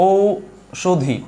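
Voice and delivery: ঔষধি stretched out very slowly, syllable by syllable; ঔষধি drawn out for the class to hear; drawn-out example. Shown as a demonstration. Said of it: "কি বলি আমরা?".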